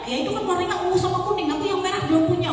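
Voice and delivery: put-on high, fast whining kid voice; whiny child. Spoiled child whining to father for red shoes, high and fast. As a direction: play a spoiled child pleading, high and rushed.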